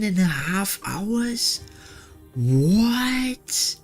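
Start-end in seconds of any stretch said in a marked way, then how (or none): none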